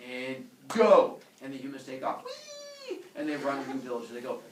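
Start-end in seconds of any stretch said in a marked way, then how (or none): 0.1-1.2 sudden loud command; commanding send-off. Acted. God sends humans village to village, loud command. 2.2-3.0 very high, soft squeal; gleeful whee. Acted. Humans take off running, high gleeful squeal.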